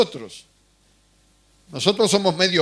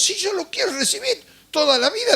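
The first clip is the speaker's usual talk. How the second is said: much higher mock voice of a naive newcomer; eager new believer; 12 semitones higher than usual. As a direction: play the eager convert, pitched way up. Imita a un creyente nuevo. (voz aguda de creyente ingenuo, en broma)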